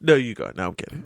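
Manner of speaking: normal talking voice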